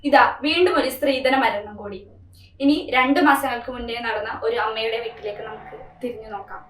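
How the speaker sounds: low, soft announcer voice; news-style narration. A narrator's reading. Narrator introducing the dowry death story, grave and low. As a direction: lead into the flashback gravely.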